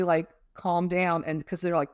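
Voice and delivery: ordinary talk, nothing marked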